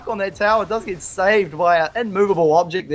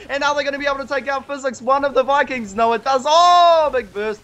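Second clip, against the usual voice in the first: excited; 8 semitones higher than usual. Real, not acted. Big hook in live cast.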